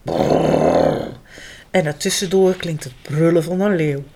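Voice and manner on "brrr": deep rumbling bear growl brrr. Acted. Bears grumbling, a deep growl.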